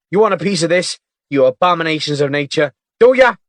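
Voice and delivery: loud, high, furious taunting. Acted. Agent waving his gun at birds, furious taunt. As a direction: threaten the birds, rising to a shriek. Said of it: "waved it furiously".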